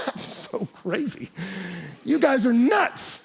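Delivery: mock-incredulous Roman crowd, drawn out; mocking disbelief. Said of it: "And the Romans were like".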